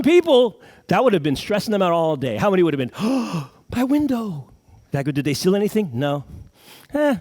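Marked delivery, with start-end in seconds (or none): 3.0-3.4 mock gasp. Imitating panicked car owner. 3.7-4.5 mock panic. Imitating panicked car owner.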